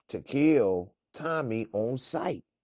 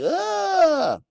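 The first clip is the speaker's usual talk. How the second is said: disgusted groan; 7 dB louder than usual. Retold or imitated. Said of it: "You'd be like".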